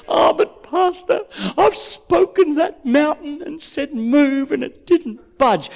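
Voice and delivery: high, slow whine to the pastor; complaining congregant. Acted. Voicing a complaining church member.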